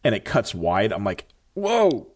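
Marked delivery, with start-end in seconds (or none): 1.5-2.1 high, startled whoa at the screen; amazed whoa. Retold or imitated. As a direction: let it jump out of you, wowed. Exclaims at a sharp car turn.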